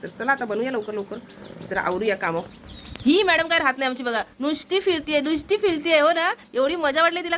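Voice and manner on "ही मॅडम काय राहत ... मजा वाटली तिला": high, fond chatter about the pet; doting pet talk. Real, not acted. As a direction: lift the voice up high as if cooing at a pet. Doting on playful pet bird.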